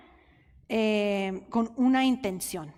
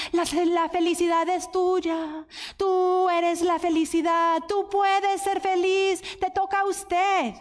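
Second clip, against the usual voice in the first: mock pop slogan; 6 semitones higher than usual. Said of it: "Ahí siempre dicen".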